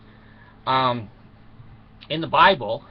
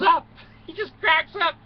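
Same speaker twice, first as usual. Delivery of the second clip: laughing; 7 dB louder than usual. Acted. Laughing retelling of Adam.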